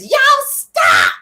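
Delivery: high, loud mock shout; retold shout. Mel shouting at the arguing pair to stop. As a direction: bark it at the two of them. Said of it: "So then Mel says".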